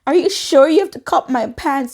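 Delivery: high, whiny tearful pleading; mock-crying, high-pitched whine. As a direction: plead as if crying over lost clothes. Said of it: "So I started crying".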